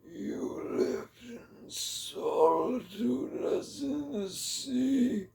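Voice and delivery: put-on very high, very slow, otherworldly old man's voice; eerie old hermit. Old forest hermit greets Zarathustra in a very high, slow, eerie voice. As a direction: stretch each word out like a strange ancient hermit.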